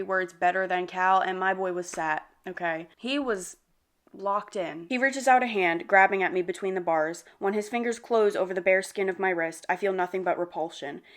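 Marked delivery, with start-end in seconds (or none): none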